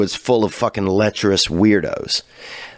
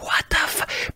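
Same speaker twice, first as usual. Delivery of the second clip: shocked whisper; 7 dB quieter, slower than usual. Retold or imitated. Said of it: "Everybody's like".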